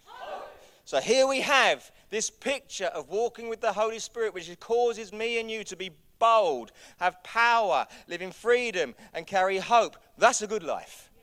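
loud, high, fired-up preaching; preaching at full force. Acted. Pastor rallying the church on boldness and power, preaching loud. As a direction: drive every line home to the room.

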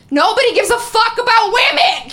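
high, loud exasperated cry; exasperated outburst. Retold or imitated. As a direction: vent it loudly in frustration. Venting imagined frustration of marching woman.

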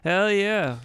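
high, loud whoop of approval; excited cheer. Real, not acted. Cheering friend's takeaway margarita news.

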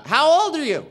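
high, loud called-out question; imitated audience girl. Girl in the audience asks the comedian, high loud call.

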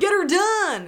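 loud, high southern holler; southern accent. Retold or imitated. Mocking the actor in the pig blood scene, southern holler. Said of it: "fucking southern accent the whole time".

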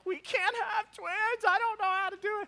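hammed-up high-pitched wail; mock crying wife. Husband imitating his wife learning of twins, mock crying. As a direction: imitate a panicked, sobbing wife in a squeaky high voice. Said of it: "my wife just starts crying".

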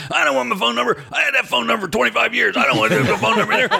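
high, fast, panicky protest; Rich whining. Retold or imitated. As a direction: fret like a nervous friend. Voicing Rich in retold argument.

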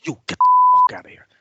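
fast, very high, loud screaming; imitating a furious yell; retold scream. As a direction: throw him out at the top of your lungs. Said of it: "launches out from the bar and goes".

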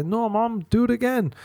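put-on teen son sneakily asking mum to kiss again; cheeky son. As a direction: beg mum for another kiss, high and pleading. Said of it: "And he'd say".

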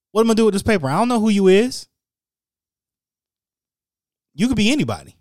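mock the clerk who won't accept the paper. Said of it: "and says".

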